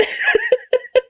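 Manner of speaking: high squealing laugh; burst of laughter. Real, not acted. Laughing that nonviolent communication fails with dad.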